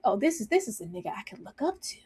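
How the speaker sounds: hushed, fast whisper of admiration; awed whisper. Retold or imitated. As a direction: breathe it like a starstruck kid. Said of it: "It's like".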